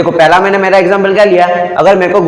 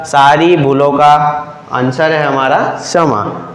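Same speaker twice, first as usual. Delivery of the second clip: slow repeated maxim; 2 semitones lower, slower than usual. Shown as a demonstration. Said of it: "देखो मैंने क्या बोला".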